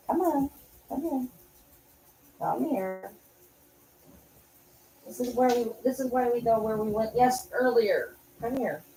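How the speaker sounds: high, soft coaxing to a dog; lilting sweet dog-calling voice. Real, not acted. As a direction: coo at the dog to come over.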